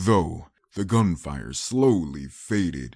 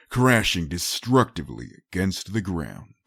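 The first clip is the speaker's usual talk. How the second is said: ordinary delivery, nothing marked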